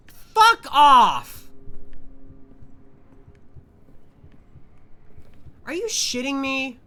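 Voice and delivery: high, loud panicked shriek; startled scream. Real, not acted. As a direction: scream in sudden fright. Startled by monster again.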